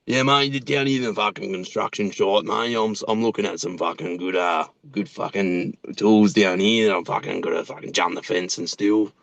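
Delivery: a hint of a rough tradie; Mike Nolan. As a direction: do the construction-site bloke. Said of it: "like Mike Nolan".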